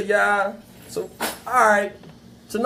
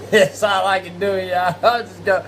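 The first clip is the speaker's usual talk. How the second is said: usual speaking voice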